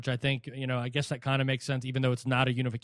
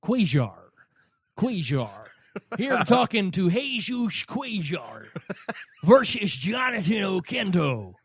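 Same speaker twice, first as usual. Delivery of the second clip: hammed-up ring announcer rolling the name, slow, higher; mock announcer; 3 semitones higher than usual. Host mocking announcers mispronouncing, hammed-up announcer. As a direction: imitate a TV caller butchering Cuellar.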